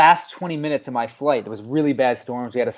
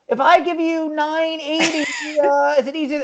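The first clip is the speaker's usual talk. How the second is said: fussy customer; 13 semitones higher than usual. Retold or imitated. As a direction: imitate the lady haggling over change. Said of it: "she's like".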